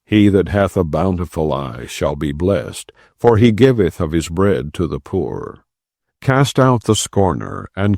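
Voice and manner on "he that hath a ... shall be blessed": ordinary talk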